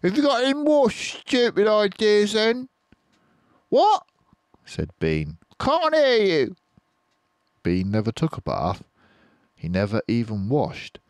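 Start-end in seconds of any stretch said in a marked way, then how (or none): 0.0-2.7 much higher, louder dwarf jeer; mock him with a rising sneer; dwarf taunting Bean; sneering Bunce. A narrator's reading. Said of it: "and said". 3.7-6.6 very high, loud squawk; deaf Bean. A narrator's reading. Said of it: "This made him deaf".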